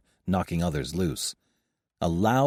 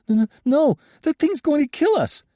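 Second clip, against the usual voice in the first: high, loud terrified stammering; panicked stammer; 8 dB louder than usual. A narrator's reading. Joshua panicking, resisting.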